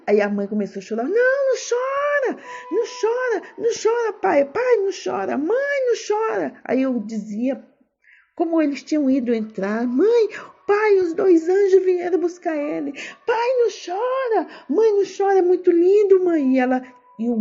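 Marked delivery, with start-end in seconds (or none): 1.1-6.6 put-on high child's voice, tearful, begging them not to cry; little girl begging her crying parents; pleading child. 9.9-16.6 put-on high child's voice, gentle and urgent; little girl comforting her parents; consoling child. Child comforting parents about angels.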